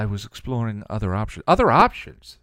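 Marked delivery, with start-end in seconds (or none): 1.5-2.4 loud, high-pitched disbelief; incredulous echo, shooting up in pitch. A narrator's reading. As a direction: throw the words back in outraged disbelief. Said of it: "she repeated incredulously".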